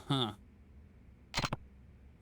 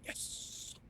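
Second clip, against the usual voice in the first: a breathy hushed whisper of delight; soft gleeful whisper; whispered yes; 18 dB quieter than usual. Retold or imitated. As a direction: whisper it like a happy secret. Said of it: "I'd be like".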